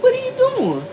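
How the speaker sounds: high-pitched mock disbelief; incredulous question, acted out; retold bewildered question. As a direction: ask it high, taken aback.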